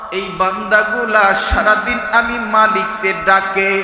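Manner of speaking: sung, swelling sermon cadence; preacher voicing God calling the angels; preaching at full force. Preacher to congregation, singing out the words in full force.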